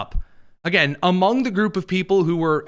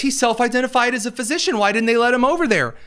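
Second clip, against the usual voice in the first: mocking the left; 7 semitones higher, faster than usual. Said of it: "And the left says".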